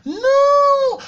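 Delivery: high, loud outcry; sudden high shout of protest. Retold or imitated. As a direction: yell it out high and sharp. Exclaiming in the retold scene.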